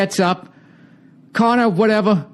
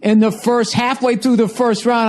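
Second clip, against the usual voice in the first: usual speaking voice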